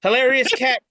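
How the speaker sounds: usual speaking voice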